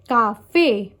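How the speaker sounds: café split up, strong rising FÉ; stress on accented syllable. Shown as a demonstration. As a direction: hit the final FÉ: ca-FÉ. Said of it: "written accent on the syllable that is stressed".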